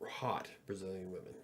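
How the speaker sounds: sly whispered aside, voice dropped away. Real, not acted. A whispered aside about women to the stream. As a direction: hush it like a naughty secret.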